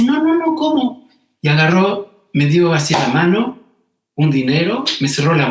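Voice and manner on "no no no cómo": high, disbelieving no no no; incredulous protest. Retold or imitated.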